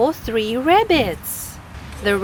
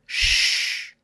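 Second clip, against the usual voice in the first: shush. Acted. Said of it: "said the birds".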